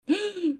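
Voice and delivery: high, quiet gasp; excited gasp. Acted. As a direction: gasp at a romantic line. Reacts to the kiss quote.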